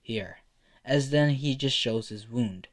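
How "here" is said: low, soft, reluctant agreement; weary and quiet, dropped in pitch; resigned sigh. A narrator's reading. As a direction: give in with a sigh. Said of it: "the old man sighs and says".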